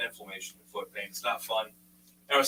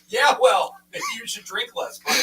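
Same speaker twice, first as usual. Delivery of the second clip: loud snarky jab, pitch jumping up; mock the customer to his face; sarcastic comeback; 14 semitones higher, 6 dB louder than usual. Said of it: "And I'm like".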